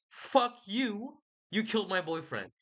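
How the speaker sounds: high-pitched, hammed-up outrage; mock spiteful Achilles. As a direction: spit it out as a petty hater, half-joking. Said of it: "pro hater".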